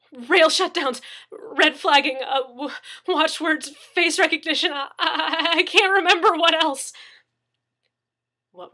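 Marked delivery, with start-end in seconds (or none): 0.1-6.9 high, loud, frantic terror; panicked blurting. Acted. Terrified captive blurting answers.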